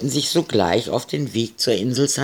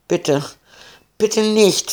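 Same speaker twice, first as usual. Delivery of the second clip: high, rising frightened pleading; 10 semitones higher, slower than usual. A narrator's reading. Captain begs the pirate with a pistol at his nose, frightened. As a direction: beg for mercy at gunpoint. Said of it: "flehte der Kapitän".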